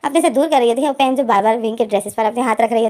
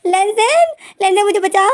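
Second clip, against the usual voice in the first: high, frightened call for help; a high panicked call, not a full scream; cry for help; 7 semitones higher than usual. A narrator's reading. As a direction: call out desperately, pitched high. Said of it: "wing जोर से चीख मारता है".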